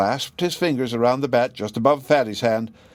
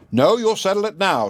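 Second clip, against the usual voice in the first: very cross; 8 semitones higher than usual. A narrator's reading. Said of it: "Very cross she was".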